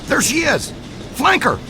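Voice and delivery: high, loud battle shouts; shouting orders. Acted. Chasing ghost, calling to team.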